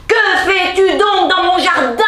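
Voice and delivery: high, loud beast voice yelling at the intruder. A narrator's reading. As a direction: roar it like an angry beast. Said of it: "hurla la bête".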